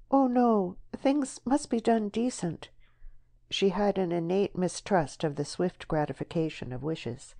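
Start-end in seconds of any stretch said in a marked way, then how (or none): 0.1-2.7 high, prim protest; voiced female character insisting on propriety; protesting young woman. A narrator's reading. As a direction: object firmly, voice pitched up. Deborah rejects his advance.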